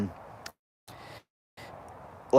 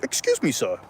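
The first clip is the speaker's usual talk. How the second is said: police officer; 8 semitones higher than usual. Retold or imitated. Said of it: "the police is like".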